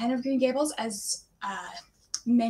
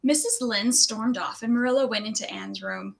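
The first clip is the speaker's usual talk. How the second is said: ordinary delivery, nothing marked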